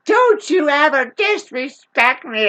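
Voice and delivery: lightly hammed-up bully getting in her face; imitating the junkie's slow threat; mock menacing junkie.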